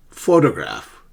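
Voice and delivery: PHO punched in "Photograph"; stress on first syllable. Shown as a demonstration. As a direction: say "Photograph" strong at the start, falling away. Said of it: "stress the right syllable".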